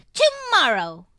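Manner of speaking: put-on high, loud toddler exclamation; a small child sure of herself; assured little girl exclaiming. A narrator's reading. Little girl answering her father, exclaiming confidently. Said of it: "she exclaims, assured".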